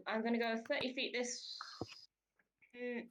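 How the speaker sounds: ordinary talk, nothing marked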